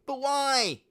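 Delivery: high, loud whine; whiny boy. A narrator's reading. Spoilt son whining to his father. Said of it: "whined Imbecilus".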